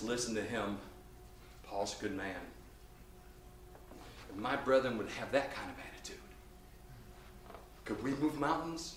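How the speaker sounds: low, soft, humble admission; Peter's heartfelt tenderness. Acted. Peter forgiving Paul before the brethren, tender and heartfelt. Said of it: "And he opens his heart".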